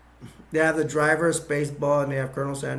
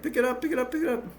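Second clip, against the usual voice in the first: pained plea; 9 semitones higher, much faster than usual. Retold or imitated. Foot crushed under statue.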